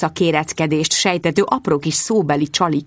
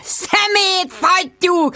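high, very slow drawn-out yell; high, slow bellowed Szemét fattyú; bellowing; 11 semitones higher, much slower than usual. Acted. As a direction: stretch out the insult as a yell. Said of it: "ordította Jude".

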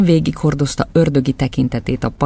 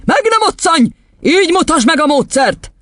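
very high, forceful command; angry outburst; 4 dB louder than usual. Acted. Headmistress forbidding the boy to move, angry yell. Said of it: "csattant fel Doron kisasszony".

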